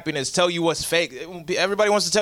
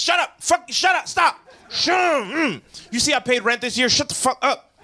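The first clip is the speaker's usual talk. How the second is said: mock grumpy yell; 7 semitones higher than usual. Grumpy adult snapping at happy kids, mock yell. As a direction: bark at the noisy kids, hammed-up.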